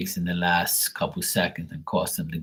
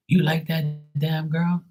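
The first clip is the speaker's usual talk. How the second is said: mocking friend voice, pitched up; friend voiced; 9 semitones higher than usual. A friend teasing him about liking a girl, higher voice. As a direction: toss it off like his teasing buddy. Friend's question retold in story.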